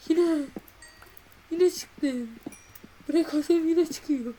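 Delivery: exasperated complaint. Real, not acted. Annoyed at repeated block.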